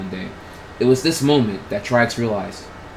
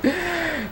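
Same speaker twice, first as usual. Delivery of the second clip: high squealing laugh; 3 dB quieter than usual. Real, not acted. Reader finishing the fan fiction, high laugh. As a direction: burst into a high-pitched giggle.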